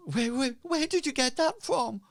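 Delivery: high-pitched, stammered demand; frantic innkeeper's wife. Acted. Wife confronting Hazra over the pendant, frantic and high. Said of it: "for his wife".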